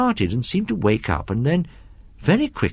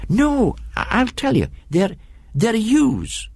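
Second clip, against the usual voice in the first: put-on much higher, eager boy's voice; eager boy correcting; 11 semitones higher, slower than usual. A narrator's reading. MacLeod corrects his friend about the dark trees, much higher and eager. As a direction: jump in to correct your friend.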